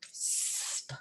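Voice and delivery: "Sp" as a long voiceless S popped into P; isolated blend. Shown as a demonstration. Teacher giving sounds for the front, "Sp" voiceless and drawn out. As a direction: sustain the S of "Sp", no vowel after. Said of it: "I wanna put sp".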